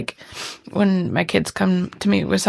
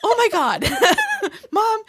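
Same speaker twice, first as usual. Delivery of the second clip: put-on high, exasperated kid voice through laughter; twelve-year-old daughter; 10 semitones higher than usual. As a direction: scold Mom like a fed-up child. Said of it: "My twelve-year-old".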